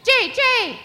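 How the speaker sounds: very high, harsh "Jay, Jay!"; blue jay call. Retold or imitated. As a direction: squawk like a toddler doing a blue jay. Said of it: "my daughter ... going".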